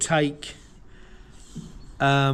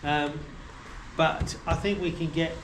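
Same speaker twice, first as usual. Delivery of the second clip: ordinary delivery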